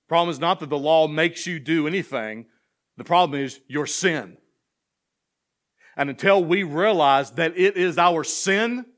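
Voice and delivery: fervent sermon cadence, climbing in pitch; full-force preaching. Acted. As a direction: preach the psalm out to the back row. Driving home the sin point.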